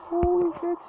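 stunned outburst; shocked exclaim. Real, not acted. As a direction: still reeling from the scare. After fright.

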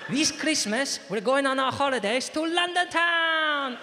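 loud, high Spanish dad announcement; Spanish accent. Acted. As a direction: build to a drawn-out Spanish-accented proclamation.

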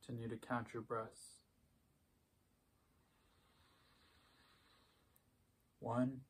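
soft, unhurried meditation voice with long pauses; hushed, even cues for noticing breath and body; guided meditation. Acted. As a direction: guide a quiet body scan, leaving space after each phrase.

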